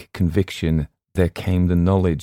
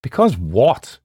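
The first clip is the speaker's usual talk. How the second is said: sudden raised, louder question; higher, urgent-sounding query; quick question; 6 semitones higher, 6 dB louder, slower than usual. A narrator's reading. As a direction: cut in quickly with a startled query. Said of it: "I asked quickly".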